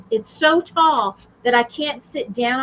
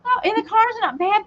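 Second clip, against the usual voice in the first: usual speaking voice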